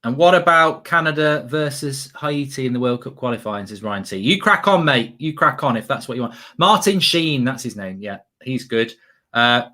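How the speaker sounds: ordinary talk, nothing marked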